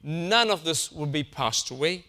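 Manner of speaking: ordinary talk, nothing marked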